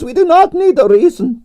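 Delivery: grand Russian declaration; proud Russian diva pronouncing a verdict; declaring. Acted. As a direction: state it as an absolute truth, in Russian accent. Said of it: "declared Arienskaya".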